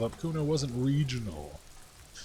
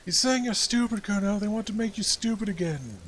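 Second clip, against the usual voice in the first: put-on high, loud, excited kid voice; shouting kid sidekick; 10 semitones higher, 4 dB louder than usual. Sidekick yelling to Kuno, high and loud. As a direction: egg the friend on, shrill with glee. Kuno's friend hollers in his ear.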